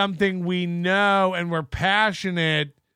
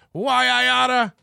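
higher-pitched, mock blustering threat; why-I-oughta bluster; 5 semitones higher, 4 dB louder than usual. Showing it's not a real meltdown, mock bluster. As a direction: put on an empty comic threat.

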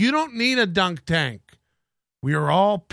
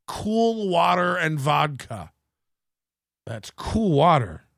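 sultry perfume ad. Pretend. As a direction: purr it like a cologne commercial.

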